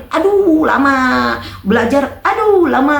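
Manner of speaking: slow, high mock complaint; mock groan. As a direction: groan about memorizing, hammed up. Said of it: "kakak ini udah agak tua".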